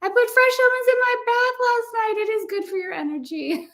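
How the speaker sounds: high, chirpy mock quote; teasing impression of a friend's comment; playful friend voice. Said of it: "Oh, Michelle, you're here".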